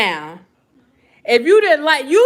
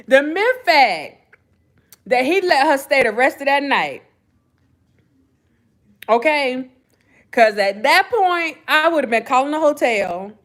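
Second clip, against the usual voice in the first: ordinary talk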